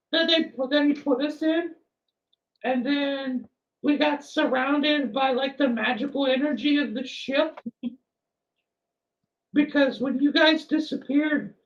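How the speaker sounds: a flustered, eager-to-please character; nervous NPC voice. Acted.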